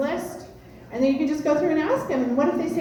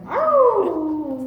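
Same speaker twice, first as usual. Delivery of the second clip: a loud, higher mock dog howl; hammed-up hound howl; 6 semitones higher, 7 dB louder than usual. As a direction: howl like the old porch dog. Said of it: "he's going".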